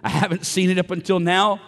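a worshipper pleading with God, loud and fervent; fervent prayer. Acted. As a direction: cry out to God with urgency. Acting out a prayer over music.

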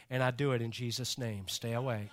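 low, soft and calm; mock serene rebuke. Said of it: "very calmly say".

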